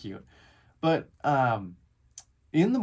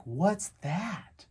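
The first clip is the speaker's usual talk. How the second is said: put-on puzzled child; 5 semitones higher than usual. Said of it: "one eyebrow is arched, his head's tilted like a dog".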